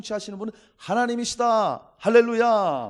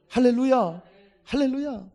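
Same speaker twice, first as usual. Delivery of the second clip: usual speaking voice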